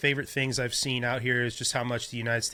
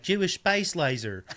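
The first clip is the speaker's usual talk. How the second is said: mocking Southern accent; 6 semitones higher than usual. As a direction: ham up a Southern politician accent. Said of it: "But when she says it's like".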